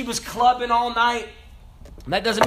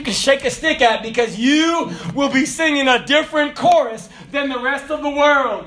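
preaching; 5 semitones higher than usual. Acted. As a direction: drive the point home from the pulpit. Preaching builds to full force.

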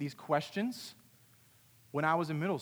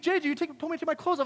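high-pitched excuse; protesting kid; 12 semitones higher, faster than usual. Retold or imitated. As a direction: act out a kid protesting his innocence. Kid protests back in acted quarrel.

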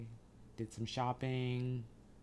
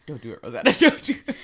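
loud laughing warning; 14 dB louder, much faster than usual. Retold or imitated. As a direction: shout it through laughter.

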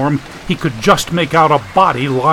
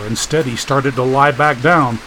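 ordinary delivery, nothing marked

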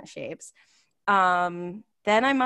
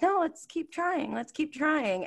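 eager; 9 semitones higher, slower than usual. Retold or imitated. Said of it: "I kept being like".

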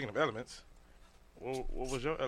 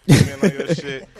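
excited; 18 dB louder than usual. Real, not acted. Hyping up friend loudly.

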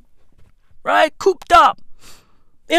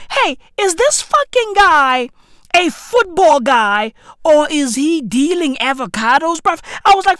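ordinary talk

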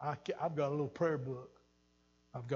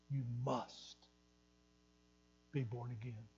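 softer, hushed voice of Jesus; a hint of Jesus, soft and grave; Jesus to Nicodemus; 7 dB quieter than usual. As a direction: lay it down gently as Jesus would. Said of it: "What did Jesus say to Nicodemus?".

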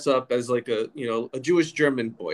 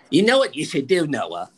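higher, louder New York mother voice; 4 semitones higher, 5 dB louder than usual. Retold or imitated. Mom advising her son, emphatic New York accent. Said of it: "And she goes".

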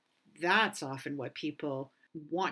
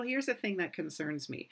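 ordinary talk, nothing marked

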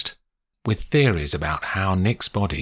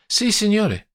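high-pitched urgent Italian woman; flustered Italian maid; 4 dB louder than usual. Acted. Maria confirms to Paddy that police have come, flustered. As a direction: confirm it anxiously, pitched right up.

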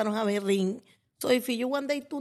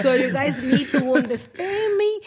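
says it with a laugh in the voice, a bit louder; laughing through words; 2 semitones higher, 5 dB louder than usual. Real, not acted. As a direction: laughs while explaining, half-joking. Jokes about her limited English.

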